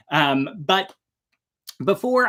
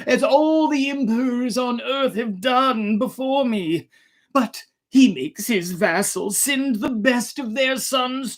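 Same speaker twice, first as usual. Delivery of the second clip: put-on lamenting old emperor; 9 semitones higher than usual. A narrator's reading. Emperor's long lament voiced.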